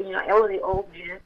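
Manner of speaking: ordinary talk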